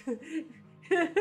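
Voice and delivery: ordinary talk, nothing marked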